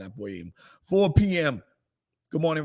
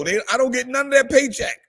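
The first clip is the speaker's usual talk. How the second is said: higher, louder and fast, playfully outraged; mock-indignant outburst; 9 semitones higher, 3 dB louder, faster than usual. Real, not acted. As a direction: blurt it out in joking indignation. Joking complaint, then repeated quietly.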